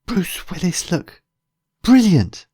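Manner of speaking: high-pitched woman's character voice; impressed, pitched well above normal; admiring Finnish colleague. A narrator's reading. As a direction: marvel at the new look.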